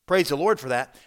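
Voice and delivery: ordinary talk, nothing marked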